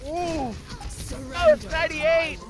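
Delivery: high, urgent overtime commentary; excited play-by-play. Real, not acted. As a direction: race through each kill as it happens. Play-by-play through overtime climax.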